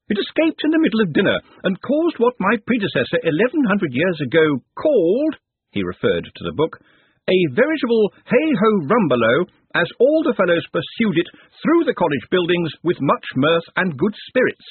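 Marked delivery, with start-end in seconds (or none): 0.1-5.4 higher pompous academic voice; pompous old wizard. Acted. Narrator voices Master of Traditions. 7.3-14.7 higher pompous academic voice; pompous old wizard. Acted. Narrator voices Master of Traditions.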